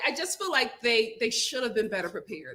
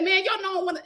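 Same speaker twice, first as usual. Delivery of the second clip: light mock L A accent, a bit higher; 8 semitones higher, faster than usual. Telling mom how [name] sounded, light mock L A accent. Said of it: "in that, um, L A accent".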